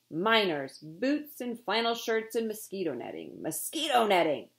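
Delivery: loud ranting captain; bellowing. A narrator's reading. Captain bellows about the rival ship's light cargo. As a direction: rant about the rival ship. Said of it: "Captain Swain bellowed".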